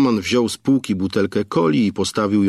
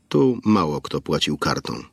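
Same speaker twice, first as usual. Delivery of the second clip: ordinary delivery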